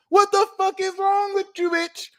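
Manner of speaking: very high, hammed-up indignant yell; mock outrage. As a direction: screech in disbelief, playing it up. Acted outrage at self-doubt.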